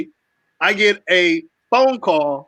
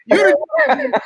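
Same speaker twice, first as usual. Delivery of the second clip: mock complaint; 9 semitones higher, slower than usual. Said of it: "I'm like".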